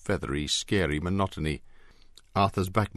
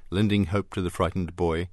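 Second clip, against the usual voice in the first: plain reading voice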